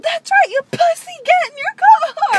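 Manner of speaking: high, loud mock shriek; imitating her yell. Retelling how the crazy woman yelled at them. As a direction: scream it like the crazy woman did. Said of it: "and she said".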